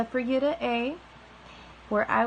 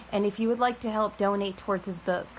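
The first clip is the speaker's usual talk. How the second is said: usual speaking voice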